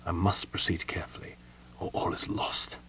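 whispered warning to oneself; very soft, private murmur; soft self-admonishment. A narrator's reading. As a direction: drop to a whisper, thinking aloud. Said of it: "He admonished himself softly".

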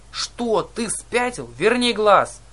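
high, shrieking indignation; outraged. A narrator's reading. Old sister demanding the eye back, outraged. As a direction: start high and incredulous, end in a loud demand.

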